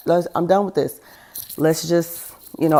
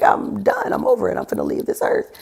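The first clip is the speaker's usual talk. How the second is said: despairing quit; 7 semitones higher, 3 dB quieter than usual. Retold or imitated. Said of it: "I just was like".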